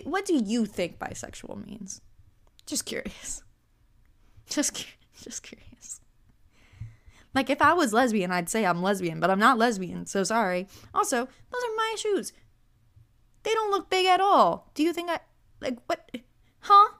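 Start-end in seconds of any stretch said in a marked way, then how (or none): 2.7-6.0 sly whispered jab; whispered mock-innocent; 1 whispered aside. Real, not acted. Sarcastic retort. 10.9-16.9 high, indignant protest; outraged at the suggestion, pitched up; incredulous and indignant; 1 indignant disbelief. Real, not acted. Indignant defence of her shoes.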